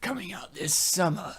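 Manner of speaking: mock horror-movie trailer narrator. Joking that the film could be recut as horror, trailer voiceover. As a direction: intone it like an ominous film promo. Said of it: "edit some of this for a horror film".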